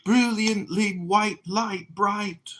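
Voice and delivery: high, slow, dreamy stage voice; drawn out, grandly theatrical. Acted. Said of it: "It's a theatrical voice".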